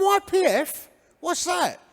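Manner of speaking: high-pitched mock bewilderment; comic incredulous query; baffled questioning. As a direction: squeak it like a clueless teenager. Teenager baffled by church jargon.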